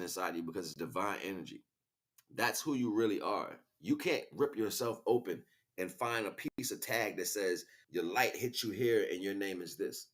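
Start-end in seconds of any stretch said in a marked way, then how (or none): none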